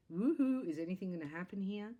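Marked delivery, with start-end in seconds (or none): 0.1-0.7 a sudden high, loud cheer; sarcastic little cheer while stuck waiting; mock woohoo. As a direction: throw out a playful whoop. Mock cheer in suspended animation.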